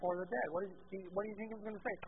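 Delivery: ordinary talk, nothing marked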